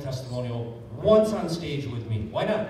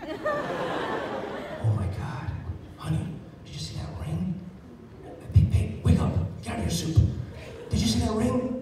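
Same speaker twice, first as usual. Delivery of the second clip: high, gasping, giggly woman nudging her partner; gushing gossip; 8 semitones higher than usual. Retold or imitated. Restaurant diner nudging her husband over the ring, excited. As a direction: hiss it to your husband, then squeal. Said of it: "Every woman in the restaurant goes".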